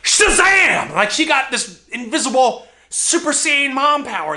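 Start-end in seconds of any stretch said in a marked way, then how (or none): 0.0-0.9 superhero shout. Retold or imitated. Mom rises like a phoenix.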